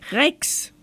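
high-pitched call to the dog. A narrator's reading. Grandma at the window to her dog, calling out. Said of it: "rief Oma Huber".

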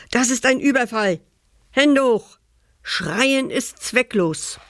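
hammed-up hold-up shout; robber's threat. Robbers ambush the old pirate.

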